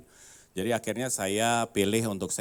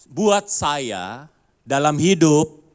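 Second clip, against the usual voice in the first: preaching emphasis; 5 semitones higher, 12 dB louder, much slower than usual. Real, not acted.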